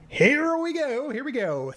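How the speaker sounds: high, slow hype call; drawn-out excited announcer call; hyped start call. Real, not acted. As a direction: stretch it out to pump the crowd up. Building hype before speedrun start.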